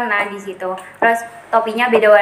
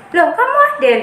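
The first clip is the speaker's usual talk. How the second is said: mock surprise, higher pitch; surprised question; 7 semitones higher than usual. Friend questioning the swapped twin, retold surprise. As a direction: act out a startled double-take question.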